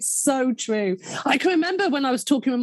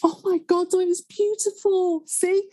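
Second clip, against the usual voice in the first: wobbly voice, tears; 7 semitones higher than usual. Real, not acted. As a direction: voice breaking with tears, rising much higher. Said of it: "you had a wobbly voice and a couple of tears".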